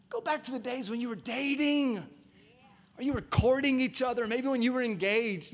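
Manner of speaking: rising exhortation. Real, not acted. Preacher rallying the congregation, excited and loud. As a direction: build it up, urge them back.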